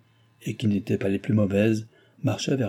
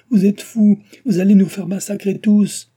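high, loud alarmed protest; frantic shouting; 10 semitones higher, 8 dB louder than usual. A narrator's reading. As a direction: shout in alarm to stop them. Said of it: "criait mademoiselle Chon".

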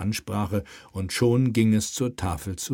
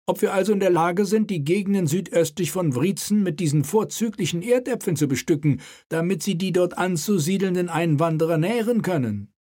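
raised, commanding royal voice; king's voice; 9 semitones higher than usual. Acted. King prods the mayor at the table, commanding.

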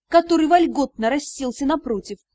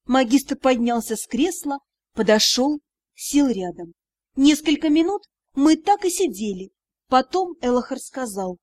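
normal talking voice

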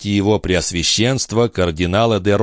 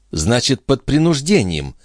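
higher-pitched princess, mildly pleased; gladdened; 6 semitones higher than usual. A narrator's reading. The princess welcomes a hint of coercion, mildly delighted. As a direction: seize on it gladly with lifted pitch. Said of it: "обрадовалась принцесса".

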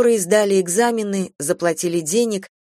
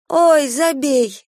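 absent-minded; 8 semitones higher, 4 dB louder, much slower than usual. Acted. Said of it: "рассеянно сказала рыцарь".

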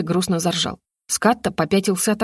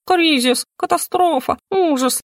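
high, frantic alarm cries; panicked squawk; 9 semitones higher, slower than usual. Acted. Bird crashing through window, shrieking in panic.

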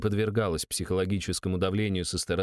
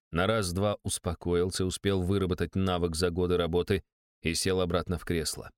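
ordinary delivery, nothing marked

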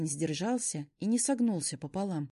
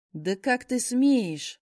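higher, louder, very slow; outraged shout; 5 semitones higher, 7 dB louder, much slower than usual. Acted. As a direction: spit out the outrage. Werewolf shoved, outraged.

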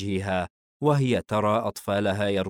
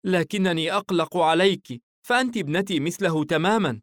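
higher, caring mother's voice; motherly concern, pitched up; loving, worried mother; 9 semitones higher, 4 dB louder than usual. Acted. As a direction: play a mother fretting over her daughter.

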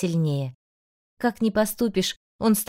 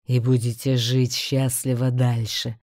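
low, slow demonic rumble; a beast speaking with slow menace; 7 semitones lower, slower than usual. A narrator's reading. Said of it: "расхохотался зверь". (низкий демонический голос)

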